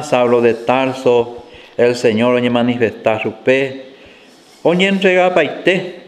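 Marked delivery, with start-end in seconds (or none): none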